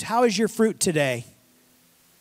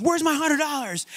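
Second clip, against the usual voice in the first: a sharp, higher demand for the money; demanding servant; 8 semitones higher than usual. Acted. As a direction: lean on him for the cash. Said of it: "He says".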